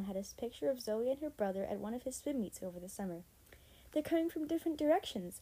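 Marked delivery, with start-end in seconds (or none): 3.9-5.4 high, mildly raised call; excited yell. A narrator's reading. Girl warning her friend of approaching players, yelling. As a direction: call out the news urgently, pitched up. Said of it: "Emma yelled".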